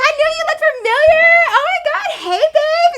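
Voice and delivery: put-on very high, breathy party-girl voice; imitating the girl. Quoting the classmate's greeting, very high girly voice. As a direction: play her as a bubbly drunk girl. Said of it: "She's like".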